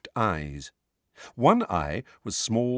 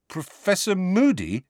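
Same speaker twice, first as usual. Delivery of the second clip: uncertain; 10 semitones higher than usual. Acted. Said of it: "he said uncertainly".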